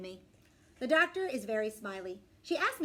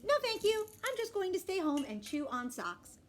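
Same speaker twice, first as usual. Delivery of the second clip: put-on higher child character voice; dog-girl's refusal; 9 semitones higher than usual. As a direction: refuse in a high little voice. Dog's barks translated, stubborn child.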